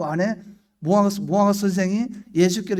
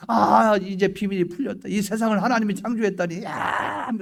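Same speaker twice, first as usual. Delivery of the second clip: raised, marvelling exclamation; acted amazement; 4 semitones higher than usual. Believer discovering that God created the world, acted wonder. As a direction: exclaim as if a mystery just opened.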